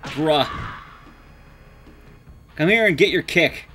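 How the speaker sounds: ordinary talk, nothing marked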